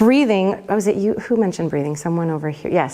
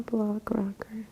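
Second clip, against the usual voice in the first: whispered aside; 3 semitones higher, 13 dB quieter than usual. Real, not acted. Aside while cueing the track.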